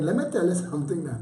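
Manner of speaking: normal talking voice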